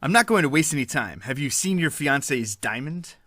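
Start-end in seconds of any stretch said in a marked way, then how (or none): none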